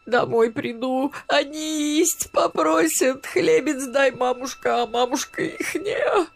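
high keening old woman's wail, half-voiced; high, tearful wailing of a grieving grandmother; wailing lament. A narrator's reading. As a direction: lament the lost ration cards in a rising wail. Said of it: "и снова запричитала, пока не в голос".

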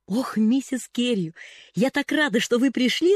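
high, loud, eager gush; chattering girl. Acted. Pollyanna greets Mrs Carew, gushing high and loud. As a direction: pour it out breathlessly happy. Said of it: "затараторила девочка".

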